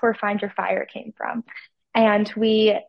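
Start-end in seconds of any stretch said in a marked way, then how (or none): none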